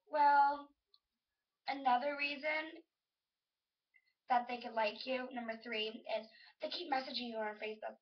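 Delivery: ordinary delivery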